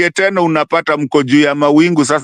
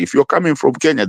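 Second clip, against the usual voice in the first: ordinary talk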